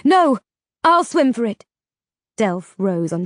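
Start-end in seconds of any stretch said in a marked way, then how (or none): 0.0-1.6 louder, higher urgent cry. A narrator's reading. Vega offering to swim to save the others, crying out. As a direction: cry out to stop him. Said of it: "cried out".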